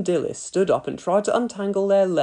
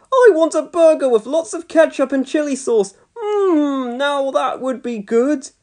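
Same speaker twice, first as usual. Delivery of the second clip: put-on greedy boy; 9 semitones higher, 4 dB louder than usual. A narrator's reading. Boy demands burger.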